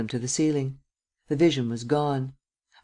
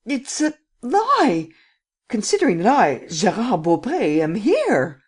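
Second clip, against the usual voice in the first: high, loud, drawn-out panic; frightened, insistent outcry; terrified protest; 8 semitones higher, 5 dB louder, slower than usual. A narrator's reading. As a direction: protest desperately, stretching the words in terror. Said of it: "stammered the terrified lad".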